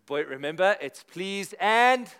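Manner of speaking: loud, slow parental reminder, stretching the last words; a father drilling manners into his boy before the sleepover; reminding his son. Retold or imitated. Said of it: "I had to encourage him and say to him".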